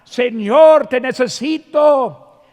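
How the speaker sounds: loud pleading cry to the Lord; desperate loud prayer. Shown as a demonstration. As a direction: call out to God with full lungs. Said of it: "como gritar".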